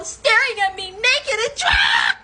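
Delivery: high, rising indignant squeal; scandalized cry. Real, not acted. Storyteller horrified at the peeping friend, scandalized cry. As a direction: squeal it in outraged disbelief. Shocked at being watched naked.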